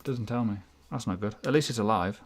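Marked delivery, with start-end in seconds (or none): none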